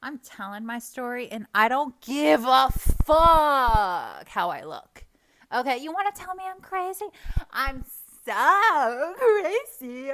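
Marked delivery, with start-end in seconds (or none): 1.5-5.1 loud, drawn-out swearing; defiant outburst. Real, not acted. Telling her story regardless of looks, defiant. 5.5-10.1 mock crazy. Taunting those who call her crazy, theatrically unhinged. Sarcastically playing up being crazy.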